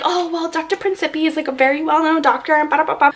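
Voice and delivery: mock-polite sing-along excuse; dismissive mimicry. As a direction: mimic their excuse, trailing into nonsense. Mimics staff praising the doctor dismissively.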